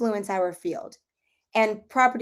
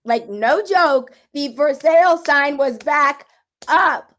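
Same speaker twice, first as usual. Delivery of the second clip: much louder and higher, slow, stressing sale; thrilled, near-laughing repeat of the news; amazed; 6 semitones higher, 12 dB louder, slower than usual. Real, not acted. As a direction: shout the disbelief, holding back a laugh. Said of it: "can't even say this without laughing".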